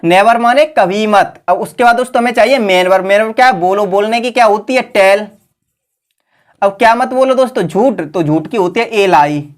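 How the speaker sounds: usual speaking voice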